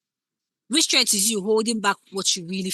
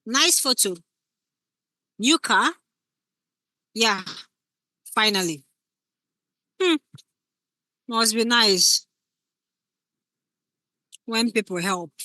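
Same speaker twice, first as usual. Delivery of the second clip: jealous subtext. Shown as a demonstration. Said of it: "You can hear exactly what she's implying".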